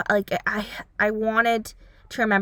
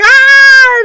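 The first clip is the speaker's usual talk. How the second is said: much higher, much louder imitated yell; mock scream; 15 dB louder than usual. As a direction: scream like Zuko shaking the guy.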